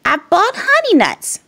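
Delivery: high, cheerful badger voice; a sly friendly badger offering treats; grinning badger. Badger offers honey nuts to his friends, high and cheerful. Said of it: "Badger says with a grin".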